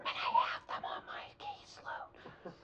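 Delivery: secretive whisper. Retold or imitated. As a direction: whisper like someone might overhear. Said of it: "Why are we whispering?".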